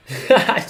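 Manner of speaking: bright burst of laughter; short laugh. Real, not acted. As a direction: laugh out loud, pleased. Laughing at the two free flags.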